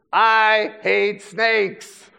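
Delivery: loud, high, very slow declaration; emphatic loathing. Acted. Preacher sharing Indiana Jones's dislike, loud drawn-out loathing. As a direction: draw out each word at high volume.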